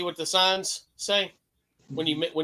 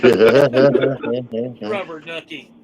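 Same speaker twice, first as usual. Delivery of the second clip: CB radio call; 5 semitones lower, 10 dB louder, much slower than usual. Pretend. Slow loud CB call.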